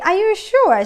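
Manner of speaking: high, pointed questioning realtor; a realtor doubting the buyer's choice, asking high and pointed. Retold or imitated. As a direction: ask it like a worried realtor making sure. Realtor double-checking the buyer.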